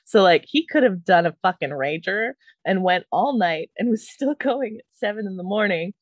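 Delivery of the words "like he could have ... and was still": ordinary talk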